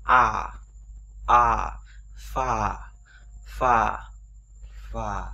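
"a" and "pha" loud and drawn long; long clear a. Shown as a demonstration. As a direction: open wide and hold a loud "pha". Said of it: "A rõ ràng và dài ra".

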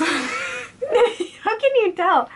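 laughing. Real, not acted. Giggling about smelly bean.

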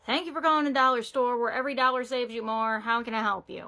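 fast, bored customer-service greeting; phone greeting. Acted. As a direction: rattle off the store greeting. Scripted store phone greeting.

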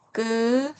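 tense the onset of 끄, no air. Shown as a demonstration. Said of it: "이렇게 읽으시면 되시고요". (된소리로 높게 끄)

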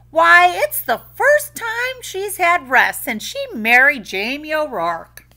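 high, loud Irish village woman; gossiping village woman. A narrator's reading. Village woman gossiping about Eileen, high and bright. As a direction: gossip with relish.

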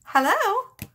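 high, loud incredulous "Hello?"; exasperated hello. Real, not acted. Reader scoffing at the ex for blaming Libra, incredulous and loud. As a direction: call it out like it's obvious.

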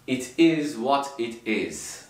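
slow, separated "It is what it is"; careful word-by-word. Shown as a demonstration. Teacher showing learners the textbook way, careful word-by-word. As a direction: say "It is what it is" slowly, every word fully sounded. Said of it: "Tabii onlar böyle "It is what it is" demiyor".

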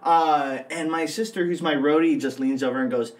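ordinary talk, nothing marked

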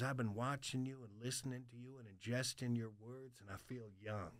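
put-on very soft, low old-timer; quiet, intimate old man's confession; old military man. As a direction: imitate the old veteran in a hushed, low voice. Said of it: "This old hard, like, military cat".

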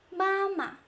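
"妈妈" first syllable high and held, second dropping short; light neutral tone. Shown as a demonstration. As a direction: hold 妈 high, then drop the second lightly. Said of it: "repetition of the first one".

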